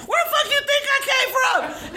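high indignant yell; angry shout. Retold or imitated. Mom yelling at startled husband, high indignant shout. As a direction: yell it back at him.

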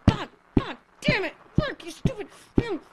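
loud, high frustrated cursing; frustrated yelling. Acted. Frustrated outburst at failed start.

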